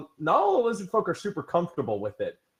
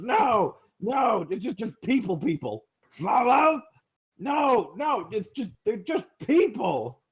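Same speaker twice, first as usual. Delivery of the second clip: creature calling back; 6 semitones higher, slower than usual. Acted. Lizard in the water shouts back at the guard.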